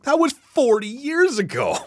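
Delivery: high-pitched, exasperated disbelief; incredulous scoff. Real, not acted. Podcaster scoffing at a writer's old credentials, incredulous.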